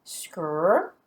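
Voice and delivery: scr said as one blended consonant cluster, no vowel; teacher modelling the blend scr; blend sound scr. Shown as a demonstration. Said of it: "S C R together says".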